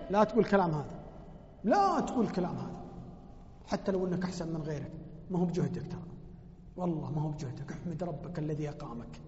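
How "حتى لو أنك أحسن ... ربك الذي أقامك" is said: soft, low, gentle advice; gentle counsel. Real, not acted. Preacher reminding the listener to thank God, softly. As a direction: lower your voice and counsel tenderly.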